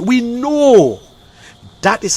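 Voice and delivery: high, loud, drawn-out assertion; emphatic insistence. Real, not acted. Ranter insisting to the people they know about rigging, emphatic.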